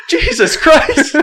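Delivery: high-pitched, drawn-out mock panic; retold alarm. Hunter retelling the shock of seeing a pistol, alarmed. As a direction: stretch it out in amused, yelped disbelief.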